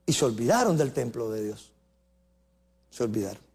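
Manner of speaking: soft, low, sorrowful drop; hushed lament. Acted. Preacher mourns the forgotten temple, soft and low. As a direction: let the voice fall away, grieved.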